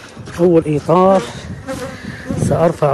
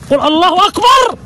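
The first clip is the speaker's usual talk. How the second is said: high, rising cry of praise; exultant cry; 7 semitones higher than usual. Real, not acted. Beekeeper praising God over his honeycombs, exultant. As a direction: call it out in wonder, pitched up. Marvelling aloud at honey frames.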